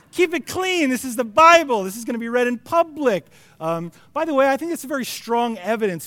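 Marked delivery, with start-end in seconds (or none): 0.1-3.2 high, loud flustered protest; pitched-up mock outrage; mock scandalised scolding. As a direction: scold someone for being too racy in church. Mock scolding of Sarah's racy words.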